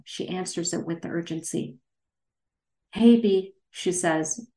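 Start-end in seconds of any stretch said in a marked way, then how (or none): 2.9-3.5 bright, loud hello; eager greeting. Acted. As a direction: greet him with urgency. Greeting partner on urgent call.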